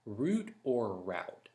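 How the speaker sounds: "route" said first as root, then as rowt; two pronunciations. Shown as a demonstration. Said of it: "I can pronounce route either way".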